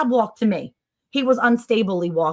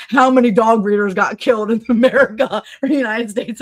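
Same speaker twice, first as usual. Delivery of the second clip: laughing question; 2 semitones higher, 8 dB louder than usual. Retold or imitated. Eric's search question, laughing.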